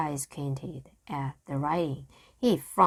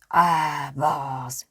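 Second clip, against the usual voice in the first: growled; 3 semitones lower than usual. A narrator's reading. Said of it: "growled Captain Bones".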